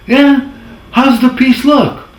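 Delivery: high, loud crooner voice on the phone. Retold or imitated. [name] phoning his lone editor, high, loud crooner impression. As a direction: ham up the famous old singer calling in. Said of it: "That's how I talk, kinda".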